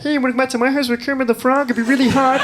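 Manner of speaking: fast, high froggy puppet voice; Kermit voice. Retold or imitated. Flirting on a date as Kermit, high silly puppet voice. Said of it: "I'll do stupid voices".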